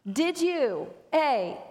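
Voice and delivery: ordinary talk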